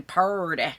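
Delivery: Southern "pretty", drawn out; Southern accent. Shown as a demonstration. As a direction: repeat "pretty" with a stretched Southern twang. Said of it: "I sound southern then".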